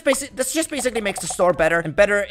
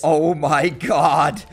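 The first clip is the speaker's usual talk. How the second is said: slow, drawn-out awe; hammed-up triumphant wonder; awestruck; 3 dB louder, much slower than usual. Real, not acted. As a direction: stretch every word in grand amazement. Marvels at the upgraded store.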